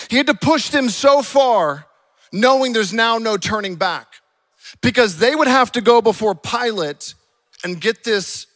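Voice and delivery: loud, high, emphatic sermon delivery; full-force preaching. Real, not acted. Preacher explaining how far Jesus pushed, forceful preaching. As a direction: drive each phrase home from the pulpit.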